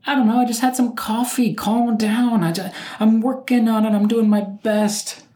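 high-pitched, defensive whine of protest; flustered protest. Acted. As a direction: plead your case in a high, strained voice. Flustered mock protest to himself.

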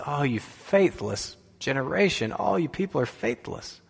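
soft exasperated sigh of a reproach; understated frustration, voice dropped in volume; quietly exasperated with the whole crowd; frustrated. Retold or imitated. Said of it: "he's frustrated with the people".

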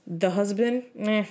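ordinary talk, nothing marked

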